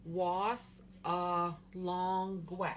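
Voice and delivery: "Was a long we" said slowly, syllables set apart; slow demonstration. Shown as a demonstration. Teacher repeating shining star for learners, slow "Was a long we". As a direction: draw out "Was a long we" piece by piece. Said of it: "So that's was a long we".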